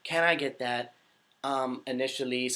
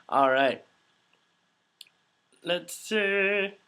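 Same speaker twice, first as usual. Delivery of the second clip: ordinary delivery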